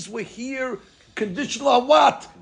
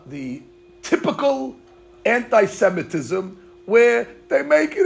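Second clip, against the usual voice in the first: usual speaking voice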